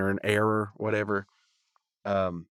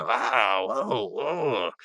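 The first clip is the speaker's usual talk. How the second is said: grossed out; much slower than usual. Retold or imitated. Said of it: "like they're grossed out by it".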